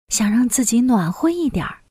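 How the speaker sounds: usual speaking voice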